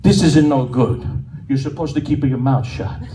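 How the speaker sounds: a hint of a stern old Italian laying down the law; mild Italian accent, emphatic; Italian grandpa. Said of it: "He says".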